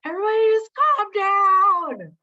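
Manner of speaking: high, loud, hammed-up order to calm down; mock shouting calm down. Mocking the useless advice to an anxious group, high mock shout. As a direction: bellow it like a frazzled boss, tongue in cheek.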